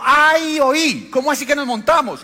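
high, loud burst of laughing disbelief; mock a stranger cracking up in surprise. Stranger on the bus laughing at the pastor, loud and high. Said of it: "empezó a reírse y dijo".

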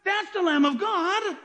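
high-pitched incredulous reaction; imitated astonished listener.

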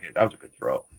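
lightly voiced referee, low and hushed; easy compliment muttered low; Jerry's voice. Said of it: "Jerry's like".

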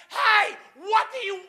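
high, sharp, impatient question; snippy tone. Shown as a demonstration. Husband asking his wife in the wrong tone, snippy. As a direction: snap the question at her like she's bugging you. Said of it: "not in that tone".

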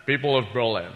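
soft, measured film-star delivery of a speech; James Mason doing President Kennedy. Acted. Film star playing president addresses Berlin, softly measured impression. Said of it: "I'll do James Mason doing President Kennedy".

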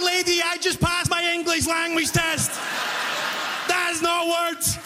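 high-pitched comic outburst; hammed-up exasperation, slowing on the last words; exasperated protest. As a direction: protest in high, strained indignation. Acted quarrel with the customer.

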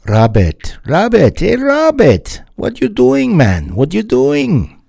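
loud, high, bad Jamaican accent calling out. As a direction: call out in a hammy Jamaican accent. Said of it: "that's terrible Jamaican accent creeping in there".

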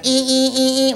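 high repeated "ee" noise; mad drivers. Retold or imitated. Cars getting cut off, high mock squealing. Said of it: "they're getting all mad".

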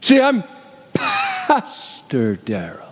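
slow, drawn-out mock self-important title; a hint of a pompous preacher. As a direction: puff up the title, then let it sag.

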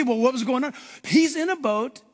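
ordinary talk

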